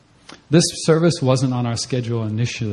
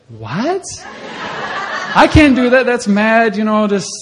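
much higher and louder, flustered; shocked protest; 10 semitones higher, 8 dB louder than usual. Retold or imitated. Teller recalling his flustered refusal to God, high and loud. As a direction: protest that it's crazy. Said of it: "And I was like".

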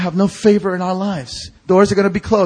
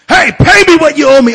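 a higher, louder, harsh voice as the servant demanding money; 10 semitones higher, 11 dB louder than usual. Acted. Unforgiving servant demanding his debt, harsh and loud. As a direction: bark it like a creditor.

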